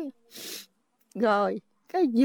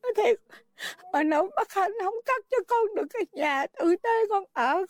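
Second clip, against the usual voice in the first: higher, sobbing lament to a grandchild; crying; 4 semitones higher than usual. Real, not acted. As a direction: grandmother weeping over her grandchild.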